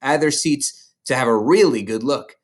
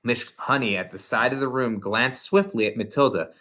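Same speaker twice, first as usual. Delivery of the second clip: usual speaking voice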